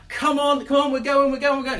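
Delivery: high, loud chivvying come on; urging a tired child. Retold or imitated. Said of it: "But, but I'm like".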